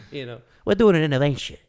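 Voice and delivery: loud, quick mobster impression; Tony Soprano. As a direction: announce it like a blustering mob boss. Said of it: "Tony Soprano".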